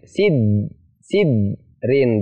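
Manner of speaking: "sidrin" with d pressed and held, no bounce; wrongly pressed d. Shown as a demonstration. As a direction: stop on the d of "sidrin", then rin.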